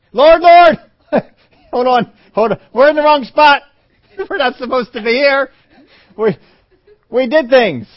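very high, loud frantic cry; panicked shout. Acted. People who find themselves in the wrong place, shouting in panic. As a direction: yell in a panic, half laughing.

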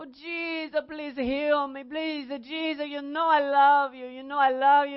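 put-on Italian accent, pleading prayer. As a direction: plead with Jesus like an Italian grandmother. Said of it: "without doing the accent".

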